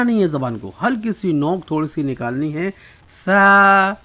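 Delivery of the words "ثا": ثا loud and high, tongue lightly between the teeth, air let out; a loud, light ثا with the tongue tip out; thin Tha. Shown as a demonstration. Teacher shows students the letter ثا, loud with the tongue between the teeth. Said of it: "یعنی باہر ہلکے سے نکالیں گے".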